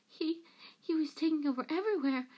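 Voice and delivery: high, stammering plea; gasped excuse. A narrator's reading. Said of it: "gasped Peter".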